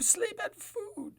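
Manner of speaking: high, slow fake sob; mock crying whine. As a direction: whimper it, half-joking. Whiny complaint.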